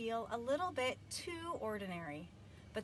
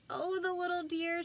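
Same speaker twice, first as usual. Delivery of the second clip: tender coo; 9 semitones higher, 4 dB louder than usual. A narrator's reading. Mrs Watson sees the piglet.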